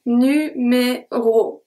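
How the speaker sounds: Numéro drawn out very slowly, open final o; slow pronunciation demo. Shown as a demonstration. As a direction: stretch Numéro so the soft o shows. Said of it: "the O at the end is a soft O".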